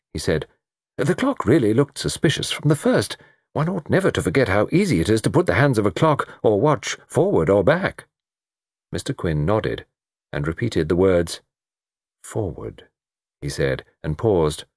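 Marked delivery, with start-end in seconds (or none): 1.0-8.1 higher, animated gentleman's voice; lightly voiced keen amateur sleuth; musing Mister Satterthwaite. Satterthwaite reasoning aloud in dialogue. 12.2-12.9 soft, low prompt; low, suggestive single word; gently encouraging. A narrator's reading. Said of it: "There was something encouraging in his voice".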